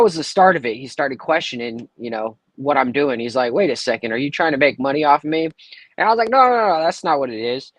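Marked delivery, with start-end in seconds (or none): none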